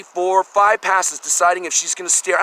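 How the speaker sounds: usual speaking voice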